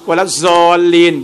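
วะละซอลีน with a buzzing "z" for dad, "walaz-zoleen"; mimicked วะละซอลีน, "z" replacing the heavy d. Shown as a demonstration. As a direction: recite วะละซอลีน as Indians or Pakistanis do, with "z". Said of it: "ก็อ่านแบบนี้".

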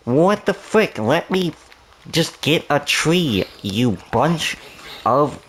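high, frustrated yelp; startled gamer, annoyed; exasperated startled outburst. Real, not acted. Mob jumps him while chopping.

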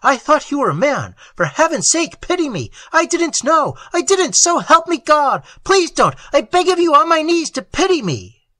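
high, loud, desperate begging; terrified sentry begging for mercy, high and loud; frightened pleading. A narrator's reading. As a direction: plead for your life in terror. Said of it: "a torrent of frightened words".

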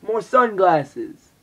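loud, high, drawn-out delight; excited find. Real, not acted. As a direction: savour the discovery slowly.

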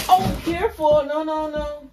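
high, sudden warning to the dog; warning the pet. Real, not acted. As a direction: stop the pet gently but urgently.

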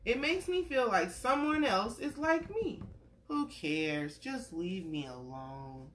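gentle storybook read-aloud; reading to a child. Acted. Parent reading a picture book to a little one, gentle storytelling.

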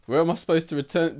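ordinary delivery

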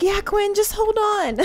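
put-on high, bright kid voice; child Bella voice. Little Bella telling Quinn to hold on, high kid voice. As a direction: squeak it like a small confident girl. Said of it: "She's like".

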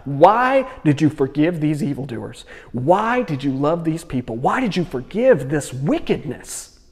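mock outrage, hammering each why; acted anger. Jonah protesting to God about the forgiven, acted raging indignation. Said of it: "He's so angry at this".

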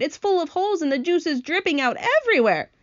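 high, alarmed report; alarmed shouting. A narrator's reading. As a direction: sound the alarm as the grasshopper. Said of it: "shouted the old green grasshopper".

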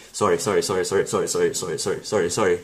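low, quiet string of automatic sorries; empty apology. Acted. Canadian apologizing for breathing near other people, empty and automatic. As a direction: rattle off mock apologies without meaning them. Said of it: "the most emptiest".

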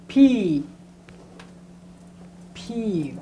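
"P" popped hard from the lips; teacher's hard P. Shown as a demonstration. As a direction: punch the "P". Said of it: "It's a hard P".

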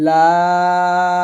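লাম held very long and light; thin lam drawn out. Shown as a demonstration. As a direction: stretch লাম slowly, thin. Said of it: "পাতলা করে উচ্চারণ করবেন".

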